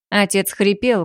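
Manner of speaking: plain reading voice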